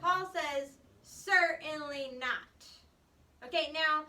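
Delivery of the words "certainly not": slow, loud, firm "Certainly not"; emphatic denial. Retold or imitated. Paul's firm refusal, said slow and loud. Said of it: "It's very emphatic".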